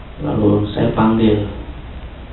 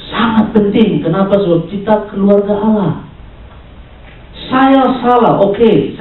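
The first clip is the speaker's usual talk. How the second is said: high, projected sermon delivery; forceful preaching; 9 semitones higher than usual. Real, not acted. Preacher urging the church family to speak truth in love, forceful preaching. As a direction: drive each point home to the congregation.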